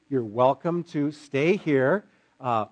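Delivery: usual speaking voice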